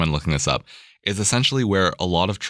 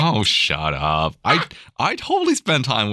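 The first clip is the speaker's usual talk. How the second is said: slow, laughing mock protest; 5 semitones higher, slower than usual. Real, not acted. Teased by wife, laughing.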